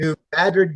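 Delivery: New Madrid as New MAD-rid, firmly stressed; local pronunciation. Shown as a demonstration. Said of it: "pronounced funny".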